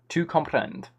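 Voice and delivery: normal talking voice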